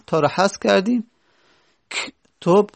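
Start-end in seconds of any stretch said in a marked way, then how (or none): 1.9-2.7 کتب begun on a bare "k", "ktob"; no vowel before the k of کتب; vowelless onset. Shown as a demonstration. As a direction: start کتب straight on the consonant cluster "kt". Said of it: "با ساکن شروع شد".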